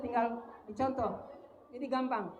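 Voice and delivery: normal talking voice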